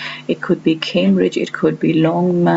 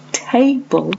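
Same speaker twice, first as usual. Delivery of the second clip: table with TA pitched higher; stress on first syllable; 6 semitones higher than usual. Shown as a demonstration. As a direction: hit the TA in table. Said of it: "the syllable stress is on the first part".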